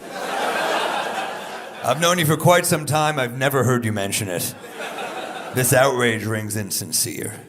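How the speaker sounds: laughing, loud put-down; loud rebuke. Retold or imitated. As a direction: call out his fake outrage loudly. Retold rebuke to friend.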